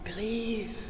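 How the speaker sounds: soft, reverent near-whisper with long pauses; hushed exhortation. Real, not acted. Preacher urging faith in the Lord, hushed. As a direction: urge belief very softly. Reverent hush after animated retelling.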